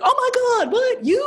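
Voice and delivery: 2 high, stunned disbelief; 3 gasp it out like you can't believe it; 1 shocked disbelief. Retold or imitated. 4 friends hearing she's bi, shocked and high. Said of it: "they're like".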